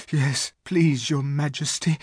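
put-on a touch of cold tremble in a boy's voice; teeth chattering. A narrator's reading. Edmund accepts a hot drink, lightly shivering. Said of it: "whose teeth were chattering".

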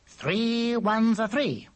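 high, slow sing-song recitation. Acted. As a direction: chant it slowly like times tables. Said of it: "Repeat after me".

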